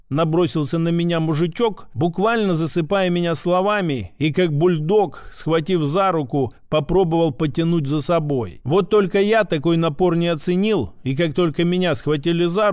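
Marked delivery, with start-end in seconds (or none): none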